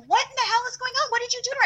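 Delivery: high squeaky mock husband; husband's alarmed question. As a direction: play the husband walking into chaos. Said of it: "He's like".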